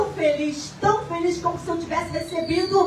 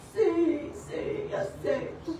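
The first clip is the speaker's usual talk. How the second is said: soft, tearful yes, almost breathless; a weeping woman quietly accepting; crying softly; 4 semitones higher, 5 dB quieter, much slower than usual. Retold or imitated. A woman in a wheelchair accepting prayer, softly tearful. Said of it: "chorando muito".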